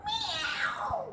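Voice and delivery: high, soft mewing meow; playful kitty meow for the kids; cat meow. Shown as a demonstration. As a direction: mew like a little cat. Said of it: "That is the sound of a cat".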